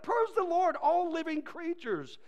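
ringing, elevated reading; exclaimed scripture. Acted. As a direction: call all creation to praise. Proclaims psalm verse.

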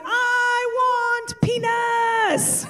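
high slow yell, "peanuts" said like "penis"; toddler mispronouncing. Retold or imitated. Toddler yells for peanuts, mispronouncing, high drawn-out yell. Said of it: "he's yelling".

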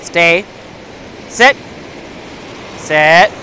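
usual speaking voice

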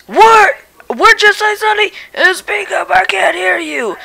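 cranky, hard-of-hearing grandpa voice; a deaf old dad barking back; grumpy old man. Acted. Son's old dad character.

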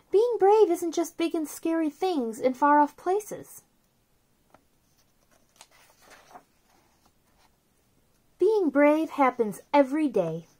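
soft, gentle read-aloud of a picture book; storybook reading. Acted. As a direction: read it softly to little kids, like a bedtime book.

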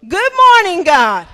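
Good morning God called out loud; proclaimed greeting. Shown as a demonstration. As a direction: announce it to the whole church. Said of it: "Say it with me".

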